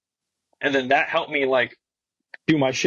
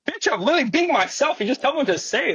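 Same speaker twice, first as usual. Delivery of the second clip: exasperated retort; 10 semitones higher than usual. Retold or imitated. Retelling his own exasperated reply.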